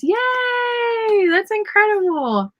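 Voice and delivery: high cheering shout; excited yay. Real, not acted. Cheering a viewer's seven hundred words. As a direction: shout it out in delight.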